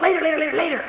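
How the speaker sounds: high, laughing mock-busy mother; mock-harried mom shooing a child; impatient brush-off. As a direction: wave the child away, hammed up. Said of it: "You just went".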